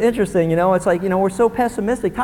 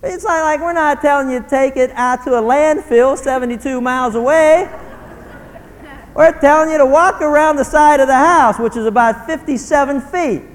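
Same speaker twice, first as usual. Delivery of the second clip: put-on higher, exasperated parent lecturing a kid; 7 semitones higher, 4 dB louder than usual. Parent to kid about taking out the garbage, exasperated. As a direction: push it out high, fed up with the excuses.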